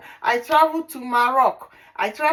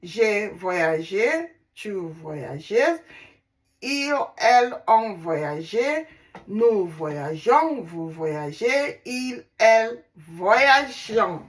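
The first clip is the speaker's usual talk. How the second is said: slow, careful conjugation drill, each form set apart; slow recital; 2 semitones lower, slower than usual. Shown as a demonstration. Teacher drilling the French verb for students, slow and careful. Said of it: "conjugating the word, uh, the verb traveling in French".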